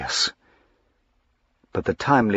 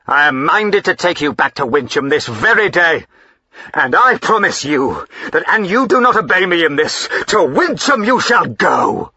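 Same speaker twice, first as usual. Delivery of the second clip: louder, higher, heavy finality; threatening; 7 semitones higher, 5 dB louder than usual. Acted. Husband threatening to take his wife to Wincham, stern threat. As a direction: threaten her with banishment.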